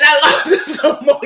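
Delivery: high, loud words broken by a laugh; laughing through tears. Real, not acted. Telling viewers how she broke down, laughing through tears. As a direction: laugh it out as the grief spills over.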